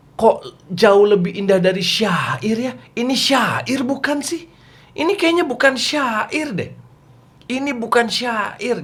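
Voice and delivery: high, slow, awed musing of a listener; amazed wonder. Retold or imitated. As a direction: marvel at it, drawn out and high. Quraisy amazed at the Quran.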